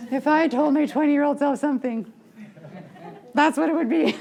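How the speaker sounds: cracking up mid-thought; laughing. Real, not acted. Laughing at own remark.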